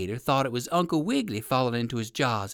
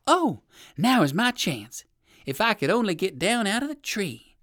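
hopeful exclaim; 6 semitones higher than usual. Acted. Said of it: "exclaimed Uncle Wiggily".

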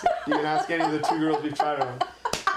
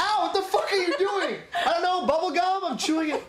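high squeaky laughing voice; 13 semitones higher than usual. Acted. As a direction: laugh through the words, pitched way up. Acting out chewing gum scene.

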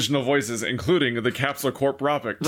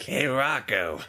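cartoon voice; much slower than usual.

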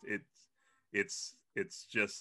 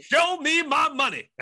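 mock shouted demand; 11 dB louder, slower than usual.

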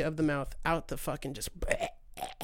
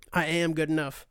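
ordinary talk

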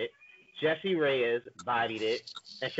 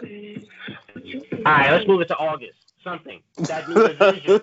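hyped shouting; 8 dB louder than usual. Real, not acted. Excited hype about album.

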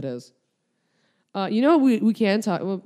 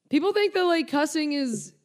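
mocking high voice; 11 semitones higher than usual. Mocking people who think cussing is dumb, in a high voice. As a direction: parody the prim view.

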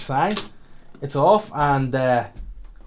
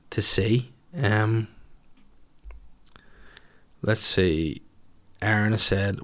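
normal talking voice

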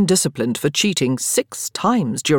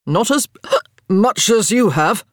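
louder, higher retort broken by a hiccup; 5 semitones higher, 5 dB louder than usual. A narrator's reading. Marcus correcting Daniel, hiccuping drunkenly. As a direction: hiccup mid-sentence while correcting him. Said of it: "he hiccuped when he said it".

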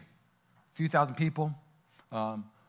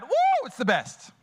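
normal talking voice

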